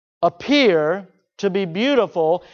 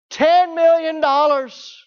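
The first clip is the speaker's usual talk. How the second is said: high, loud, drawn-out disbelief; raised, astonished preacher's cry; incredulous exclamation; 9 semitones higher, 5 dB louder, slower than usual. Real, not acted. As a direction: slowly belt out the outrageous sum. Repeats the outrageous sum.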